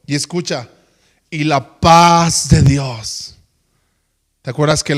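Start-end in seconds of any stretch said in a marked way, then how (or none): 1.3-3.4 slow, loud, drawn-out "y la paz de Dios"; slow and solemn. Acted. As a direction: linger on every word. Slow scripture emphasis.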